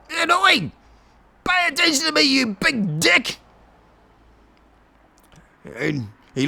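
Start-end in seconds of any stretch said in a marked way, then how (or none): 0.1-3.4 cheeky bellow; shouted taunt. Acted. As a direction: shout down into the dragon's ear. Cat yells on dragon's head.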